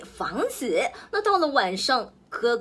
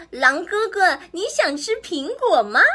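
sly offer; 6 semitones higher, 3 dB louder than usual. A narrator's reading. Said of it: "老三说".

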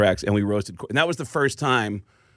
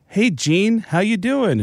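friendly phone greeting; 10 semitones higher, slower than usual. Retold or imitated. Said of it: "he goes".

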